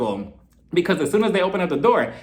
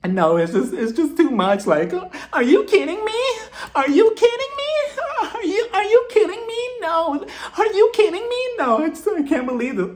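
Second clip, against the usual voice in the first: much higher, squeaky mock outrage; shrill Lisa disbelief; 12 semitones higher than usual. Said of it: "Lisa's like".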